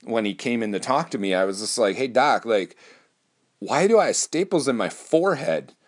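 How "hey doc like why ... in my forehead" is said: higher-pitched, baffled patient's question; acted-out puzzlement, pitched up; puzzled questioning. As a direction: retell it as a bewildered patient quizzing the doctor. Acts out his puzzled question to surgeon.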